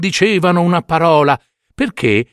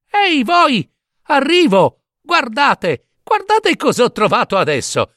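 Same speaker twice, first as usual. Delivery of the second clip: much higher, eager call; excited calling out; 12 semitones higher than usual. Acted. Giambabbeo calling his brothers to see his new find, high call. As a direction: shout it ahead proudly.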